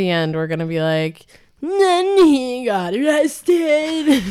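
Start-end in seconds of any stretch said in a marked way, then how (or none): 1.6-4.2 put-on slow, slurred drunk voice. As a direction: slur it like you're wasted. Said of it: "That was not a good drunk voice".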